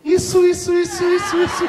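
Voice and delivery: put-on high squeaky kid-character voice; high childish Chaves impression; Chaves catchphrase. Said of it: "Ele olhou pra mim e falou".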